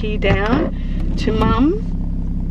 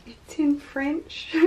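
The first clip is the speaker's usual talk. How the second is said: ordinary talk, nothing marked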